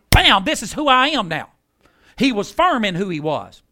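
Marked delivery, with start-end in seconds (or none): none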